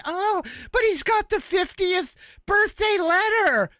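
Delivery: high sarcastic imitation of an opponent; mocking voice. Mocking a leftist argument.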